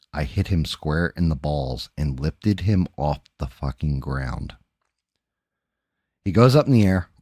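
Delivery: ordinary talk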